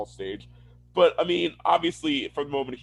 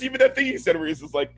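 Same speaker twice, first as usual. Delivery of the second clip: usual speaking voice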